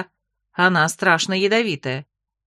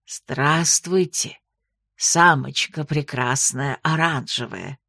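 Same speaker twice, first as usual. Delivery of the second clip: low, slow, quirky creature voice; odd night porter voice; 6 semitones lower, much slower than usual. A narrator's reading. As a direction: drawl the compliments oddly. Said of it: "раздался вдруг голос Феликса".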